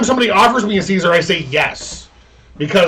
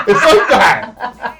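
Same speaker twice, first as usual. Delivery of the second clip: laughing; 6 semitones higher, 5 dB louder, much slower than usual. Real, not acted. Laughing aside.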